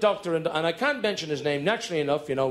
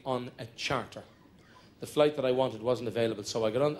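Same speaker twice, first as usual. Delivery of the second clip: ordinary talk, nothing marked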